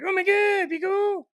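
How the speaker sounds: very high, loud mock panic; panicky wife impression. Retelling his wife's reaction to Gina, high mock squeal. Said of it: "and she's all like".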